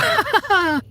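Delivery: very high cackle; jeering laughter. A narrator's reading. The dead mocking the general, cackling laughter. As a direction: cackle gleefully along with the crowd. Crowd jeers after a jibe.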